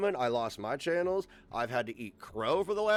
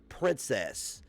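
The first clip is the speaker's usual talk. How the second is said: normal talking voice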